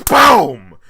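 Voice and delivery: sudden loud, high "boom"; comic punch noise cutting in; mock punch impact. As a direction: burst out with an explosive sound effect. Acts out punch cutting granny off.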